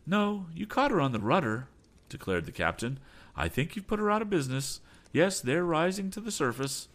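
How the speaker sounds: the captain reports the damage. Acted. Captain voice explaining.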